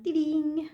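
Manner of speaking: high sung little ding sound; vocal jingle sound. Pretend. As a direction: chime it like a notification. Voices a jingle again.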